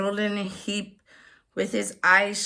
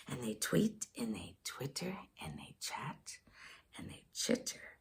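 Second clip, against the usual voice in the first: whispering; 8 semitones lower, 16 dB quieter, faster than usual. Acted. Hushed storytelling.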